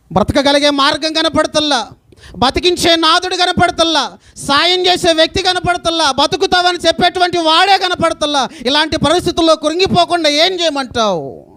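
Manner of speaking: preacher crying out her hopelessness; building impassioned preaching. Acted. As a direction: build the litany to a peak. Rising litany of despair.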